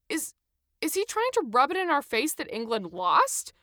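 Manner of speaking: loud, high disbelieving outburst; can't believe what he's hearing; Adam in loud disbelief at the gloating checker; incredulous. A narrator's reading. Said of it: "Adam said incredulously".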